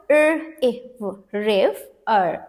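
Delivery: "र, इव" blended into "रिव" with short i, then "अर"; sounded out. Shown as a demonstration. Teacher re-reads after the new split, sounding out "रिव" with short i. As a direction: separate sounds joined into a closed syllable "रिव". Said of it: "अब ये है closed syllable word".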